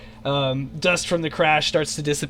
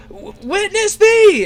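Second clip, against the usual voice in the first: high, loud, drawn-out ceremonial cry; 8 dB louder, slower than usual. Acted. Adria crying out to the crew as she redeploys the cell. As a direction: call it out slowly like a holy invocation. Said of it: "she cries out".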